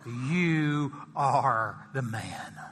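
very slow, low, solemn accusation. Acted. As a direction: lay it on him slowly. Prophet accusing the king.

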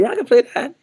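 very high, eagerly sure reply; total confidence. Retold or imitated. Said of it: "I had total confidence".

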